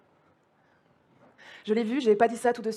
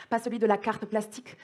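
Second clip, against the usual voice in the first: usual speaking voice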